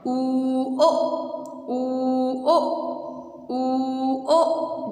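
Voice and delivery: each ऊ held, each ओ quick, pauses between; long, then short. Shown as a demonstration. Teacher drilling double-O sounds, long ऊ and short ओ. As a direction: alternate a drawn-out ऊ with a very short ओ. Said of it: "first sound long है, second sound short है".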